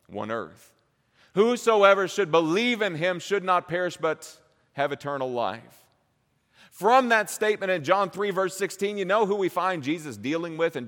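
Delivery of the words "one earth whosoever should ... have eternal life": loud, high, driving sermon climax; preaching at full force. Acted. Preacher urging the congregation, at full force. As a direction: preach it out with rising fire.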